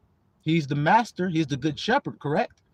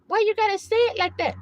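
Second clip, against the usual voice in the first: high-pitched mock complaint; offended listener; 13 semitones higher than usual. Objector to how the pledge was worded, mock offended. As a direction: imitate someone scandalized, pitched way up.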